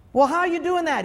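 high, loud amazed question from the time traveler. Retold or imitated. Time traveler demanding how the phone works, high and amazed. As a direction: ask it like you can't believe it. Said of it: "he says".

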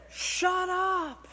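very high, drawn-out mock command; God's rebuke. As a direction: cut off the whining in an exaggerated high voice. God's retold sudden rebuke.

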